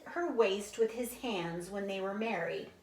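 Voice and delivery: plain reading voice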